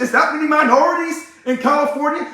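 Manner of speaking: ordinary delivery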